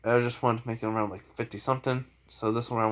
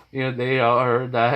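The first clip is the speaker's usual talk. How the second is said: ordinary delivery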